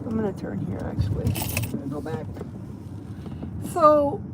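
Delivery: ordinary talk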